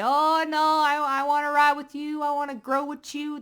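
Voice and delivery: high, loud, lightly voiced fake sweetness; mock pleading. Reader mocking manipulators who fake loyalty in a high voice. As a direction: mimic a clingy user begging.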